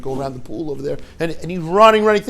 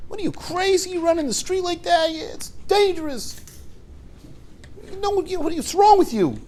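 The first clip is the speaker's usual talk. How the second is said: retold yelling; 13 semitones higher, 4 dB quieter than usual. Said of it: "He starts yelling at the guy".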